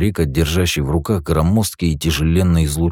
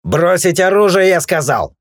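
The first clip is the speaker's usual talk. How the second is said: high, loud slow shout; yelled order; 13 semitones higher, 6 dB louder than usual. A narrator's reading. Guard yells at the brawlers to disarm, loud. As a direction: roar the command. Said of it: "заорал хранитель".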